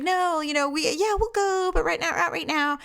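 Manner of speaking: a stalling, wheedling guy, lightly hammed; imitating Larry. Larry stalling her, voiced.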